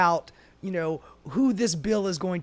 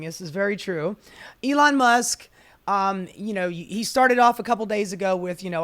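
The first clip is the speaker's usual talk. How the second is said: normal talking voice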